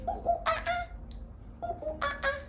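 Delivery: high playful monkey hoots; monkey call. Acted. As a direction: imitate a monkey for children. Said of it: "A mono says".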